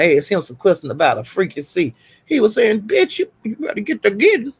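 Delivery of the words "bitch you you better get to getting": higher-pitched mock telling-off; mock going off. Acting out his rant at another man, mock going off. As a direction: imitate a man cussing someone out. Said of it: "That's how he was talking".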